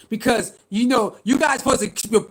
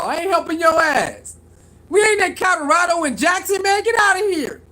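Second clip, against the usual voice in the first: mock Travis refusing; 5 semitones higher than usual. Acting Travis refusing Shiloh.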